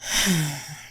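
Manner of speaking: long exhaled sigh; weary sigh. Real, not acted. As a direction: let out a heavy breath. Weary of explaining the plot.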